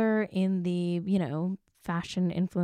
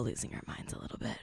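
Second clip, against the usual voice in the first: whisper; 9 semitones lower, 14 dB quieter, faster than usual. Retold or imitated. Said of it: "I think he's like".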